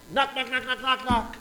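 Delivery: loud spoken knock knock knock; knocking sounds. Acted. Preacher acting out knocking at the neighbor's door, loud. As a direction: rap out the knocks loudly.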